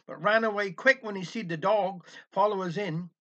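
usual speaking voice

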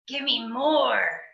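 croaky greedy bird; croaking bird voice. Acted. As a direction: rasp it like a greedy buzzard. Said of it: "that beast said".

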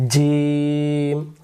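জিম with a hard j and a long held vowel; firm articulation. Shown as a demonstration. Teacher models the letter from mid-tongue, জিম firm and drawn out. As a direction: press mid-tongue to palate and stretch জিম slowly. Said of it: "শক্ত করে জিহ্বার মাঝখান থেকে".

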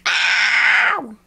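long loud "ow"; drawn-out pretend yelp; mock pain howl. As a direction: cry out as if hit in play. Hit by the play attack.

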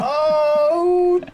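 startled cry. Real, not acted. Real dismay at race spot.

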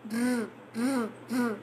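a low, soft curled "r"; American R sound. Shown as a demonstration. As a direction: hold the tongue curled on "r". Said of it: "the sound of R".

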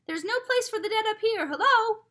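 high, loud shout after a stranger. A narrator's reading. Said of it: "called Father".

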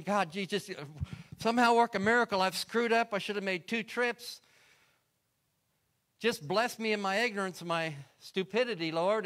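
pleading loud and high, begging God in a panic; desperate prayer. Retold or imitated. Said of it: "I'm pl-, praying in desperation".

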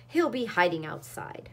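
ordinary delivery

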